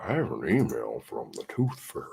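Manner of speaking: mock serious. Shown as a demonstration. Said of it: "That just sounds weird saying that".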